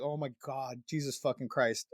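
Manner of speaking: low, hushed retold cursing; muttered exasperation. Dad reacting to daughter's plans, muttered exasperation. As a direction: curse under your breath, worn down.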